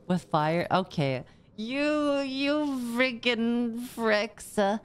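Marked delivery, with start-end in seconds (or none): 1.6-4.8 high, drawn-out mock outrage; mock scolding. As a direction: stretch out every word in playful indignation. Mock scolding chat over the lighter.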